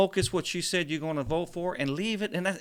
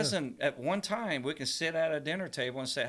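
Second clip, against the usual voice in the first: ordinary delivery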